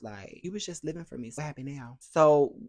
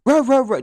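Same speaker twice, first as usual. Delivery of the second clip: mock fumbling noise; 11 semitones higher, 11 dB louder than usual. Imitating camera fumbling noise.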